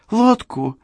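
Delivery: bright, delighted high voice; gleeful high voice; happy excited. A narrator's reading. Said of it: "Черил радостно засмеялась".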